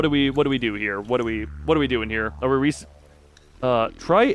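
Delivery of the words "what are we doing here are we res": ordinary delivery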